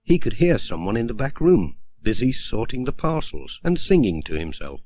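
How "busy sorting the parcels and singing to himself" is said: ordinary delivery, nothing marked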